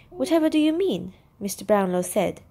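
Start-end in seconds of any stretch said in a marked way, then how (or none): 0.1-1.1 high-pitched, startled question; puzzled alarm. A narrator's reading. Brownlow questions Nancy's warning about Oliver, taken aback.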